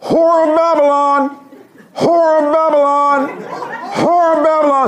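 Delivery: long, high-pitched holler; slow, drawn-out yell at full volume; top-of-his-voice shout. Retold or imitated. Evangelist denounces the woman on the airplane, shouting at the top. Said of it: "at the top of his voice".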